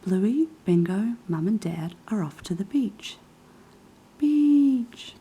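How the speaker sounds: whispered picture-book reading, a touch livelier; reading to children. Acted. Whispering a Bluey book aloud for ASMR viewers, gentle reading. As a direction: read the story like bedtime, hushed.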